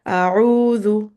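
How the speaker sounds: أعوذ with a clear open ayn and voiced dhal; model recitation. Shown as a demonstration. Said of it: "I will start with one word at a time".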